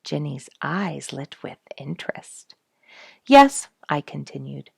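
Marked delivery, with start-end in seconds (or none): 3.2-3.7 loud, high eager yes. Acted. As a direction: burst out with enthusiasm. Eager reply to Ginny.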